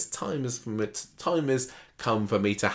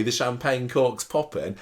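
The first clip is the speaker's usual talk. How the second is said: ordinary talk, nothing marked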